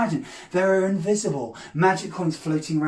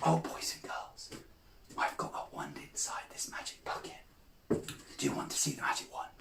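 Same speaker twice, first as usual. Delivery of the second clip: very hushed, conspiratorial whisper; secretive whisper; 18 dB quieter than usual. Acted. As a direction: let the children in on a secret. Secretly reveals a hidden wand.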